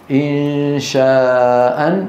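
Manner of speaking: إن شاء with the long alif held slowly; slow madd. Shown as a demonstration. Teacher showing the pausal madd slowly. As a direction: draw out إن شاء. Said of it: "نحو".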